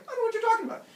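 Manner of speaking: loud, high mock denial; feigned innocence. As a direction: deny everything in a squeaky panic. Caught liar denies it.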